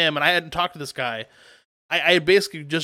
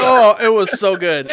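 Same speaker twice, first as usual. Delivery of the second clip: laughing; 4 semitones higher, 7 dB louder, slower than usual. Real, not acted. Still laughing.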